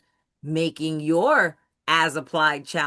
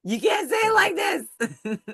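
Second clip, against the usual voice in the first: high, drawn-out mock wail breaking into laughter; laughing mock plea; 11 semitones higher than usual. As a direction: plead in a slow, high, laughing cry. Mock pleading with class, laughing.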